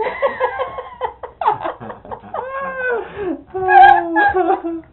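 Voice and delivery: high, loud laughter nearly crying; long helpless laugh fading out; real laughter. Real, not acted. Long laugh after the punchline.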